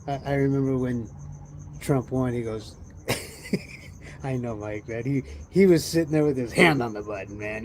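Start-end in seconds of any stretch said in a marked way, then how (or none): none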